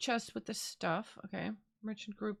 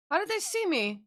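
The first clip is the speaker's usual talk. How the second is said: indignant disbelief; 7 semitones higher, 7 dB louder than usual. Real, not acted. Spotted again, indignant.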